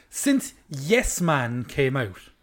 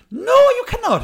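much higher, loud mock outraged shout; outraged refusal; 9 dB louder than usual. Refusing the customer's badly said order, shouting.

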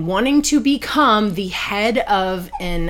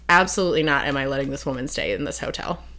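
low, fast, under-the-breath refusal; firm refusal; 5 semitones lower, 5 dB quieter, much faster than usual. Retold or imitated. Ben looking around the sketchy hotel, firm refusal. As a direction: quietly act out a man refusing outright.

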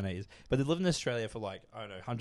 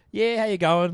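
put-on Australian accent; 6 semitones higher, 8 dB louder than usual. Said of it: "she sounds more Australian than me".

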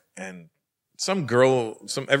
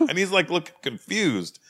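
ordinary delivery

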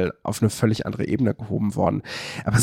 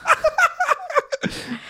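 bursting into a high laugh; high amused laugh; real laugh; 3 dB quieter than usual. Real, not acted. Laughs at own shuffle mishap.

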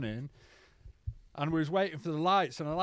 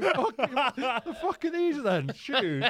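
high, slow, laughing through the words; laughing at his own old self; laughing disbelief; 8 semitones higher, slower than usual. Retold or imitated. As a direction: crack up as you ask it. Retelling his own confusion.

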